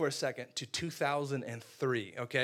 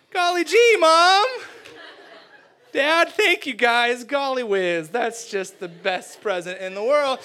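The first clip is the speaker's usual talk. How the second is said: loud, high, overdone thanks, settling slower; fake gratitude; 7 semitones higher, 11 dB louder, slower than usual. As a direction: gush to cover disappointment. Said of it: "But inside I'm thinking".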